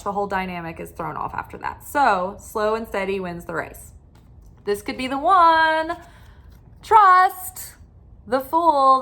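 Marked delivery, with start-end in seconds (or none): 4.6-9.0 higher and louder, building on trust; bright rising card reveal; excited announcement. Real, not acted.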